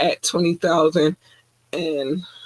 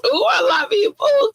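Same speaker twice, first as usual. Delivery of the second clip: squealed shout. Real, not acted. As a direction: shriek your love out to the fans. Shouting love to fans.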